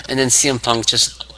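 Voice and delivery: ordinary talk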